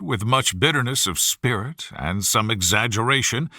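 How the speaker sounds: ordinary delivery, nothing marked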